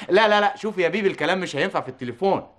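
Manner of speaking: ordinary talk, nothing marked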